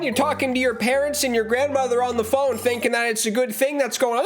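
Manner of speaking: normal talking voice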